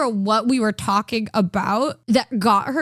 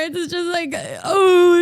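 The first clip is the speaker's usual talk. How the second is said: normal talking voice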